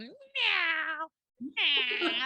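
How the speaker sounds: lightly voiced mock cat meows, drawn out; imitating a diseased cat. Host imitating Tabitha pretending to be a cat, mock meowing. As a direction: meow like a sickly stray, half-hearted. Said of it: "like that".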